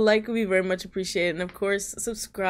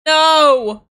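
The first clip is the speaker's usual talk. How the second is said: sudden loud cry of no; shout; 8 semitones higher, 12 dB louder than usual. Real, not acted. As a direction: yell it in alarm. Bat takes off, frantic cry.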